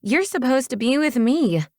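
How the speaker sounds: put-on high, petulant girl's voice; complaining. A narrator's reading. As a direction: whine that he's neglecting you. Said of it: "she complained".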